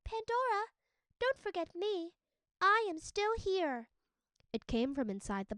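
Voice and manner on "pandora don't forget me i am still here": much higher, gentle calling voice; small calling voice. A narrator's reading. Hope calling Pandora from the box, high and small. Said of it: "heard another voice calling".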